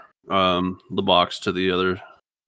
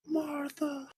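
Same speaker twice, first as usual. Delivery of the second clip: much higher, softly savoured name; geeky Doctor Who moment; 11 dB quieter than usual. Shown as a demonstration. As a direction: breathe the name like a fan quoting. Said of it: "I was just having a geeky moment".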